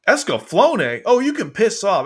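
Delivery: loud, high-pitched outrage; indignant outburst. Real, not acted. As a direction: explode at an insult to your favourite. Outraged by a show being listed.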